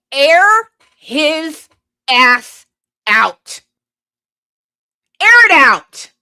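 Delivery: high, loud furious yell; angry shout. Real, not acted. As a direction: bellow it out in pure rage. Furious rant about a politician.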